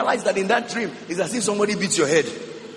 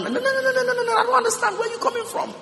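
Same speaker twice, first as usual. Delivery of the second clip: much higher, fast laughing no no no; high mocking no; faster than usual. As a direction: squeak the refusal in mock disbelief. Mocks baffled voice.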